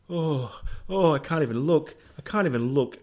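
high, loud mock panic; mum freaking out. Mum panicking on steep mountain track, mock fearful. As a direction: act out a scared mum on a cliff road. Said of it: "Mum would be freaking out".

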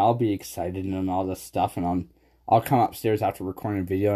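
ordinary talk, nothing marked

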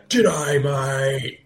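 hammed-up Australian greeting; Australian accent. Shown as a demonstration. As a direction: put on a broad Australian accent. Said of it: "This is me doing an Australian accent".

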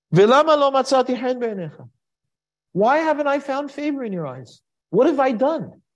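high, pleading and loud, near breaking; anguished plea. Acted. Moshe pleads with God, hurt and desperate, voice high and pleading. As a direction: cry out to God in despair.

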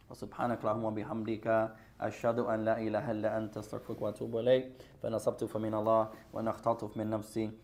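rapid, soft recitation of the closing dua; fast memorised Arabic recitation at the end of a talk; fast closing prayer. Acted. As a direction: rattle off the closing prayer quickly and quietly.

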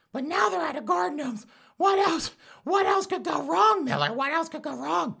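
very high falsetto, building to a shrill shout; mock fretting listener. Imitating a listener wailing to Miller, in high falsetto. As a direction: whine it high and overwrought, rising to a squeal. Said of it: "if you're like".

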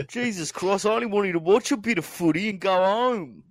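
put-on high, laughing Australian accent; hammed-up Aussie fan grumbling; aussie tradesman. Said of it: "that is terrible, Joe".

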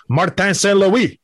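"Martin Saint Louis" said the French way, MAR-TAN SAN LOO-EE; mock French pronunciation; French accent. Shown as a demonstration. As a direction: give the name a French accent. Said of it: "Put the, the French accent on it".

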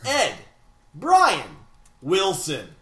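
slow names with big swooping pitch; over-the-top mock-epic roll call; epic announcer. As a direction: draw out each name as if crowning champions. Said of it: "That was way too epic".

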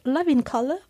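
French speaker doing a British accent. Shown as a demonstration. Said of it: "ou avec un accent british".

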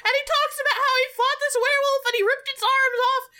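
high voice squeezed through laughter; laughing retelling. Real, not acted. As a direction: tell the absurd story while cracking up. Retelling speech while cracking up.

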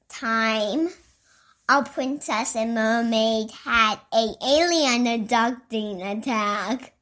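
ordinary delivery

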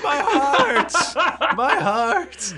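high, hammed-up wail; satyr feigning collapse. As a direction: cry out like a melodramatic dying man. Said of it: "And he goes".

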